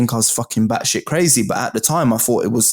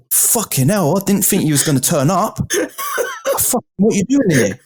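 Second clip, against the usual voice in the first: raised, startled disbelief; shocked exclamation; 8 semitones higher than usual. Retold or imitated. Surprised boss reacting to his arrival. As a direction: barber stunned he actually came.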